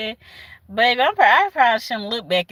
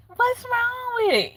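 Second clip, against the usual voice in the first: high, slow imitation of defenders; mock clueless question; 11 semitones higher than usual. Mimicking people who defend the lyrics, mock clueless. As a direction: mimic them asking, high and drawn out.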